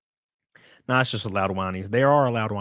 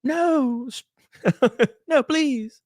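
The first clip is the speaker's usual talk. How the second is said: high squealed protest; playful high-pitched plea; mock pleading; 14 semitones higher, much slower than usual. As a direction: beg in a tiny falsetto, half laughing. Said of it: "and I'm like".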